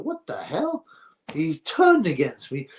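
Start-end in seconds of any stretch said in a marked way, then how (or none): 0.0-0.9 shocked. Retold or imitated. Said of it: "I was like".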